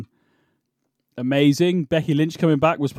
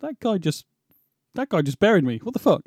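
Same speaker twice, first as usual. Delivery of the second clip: delayed realization; 5 semitones higher than usual. Retold or imitated. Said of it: "you go".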